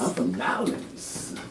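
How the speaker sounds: slow, soft New Orleans drawl on "Nowlins"; New Orleans accent. Retold or imitated. Playing a local telling where they're from, New Orleans accent. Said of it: "I like the way they talk".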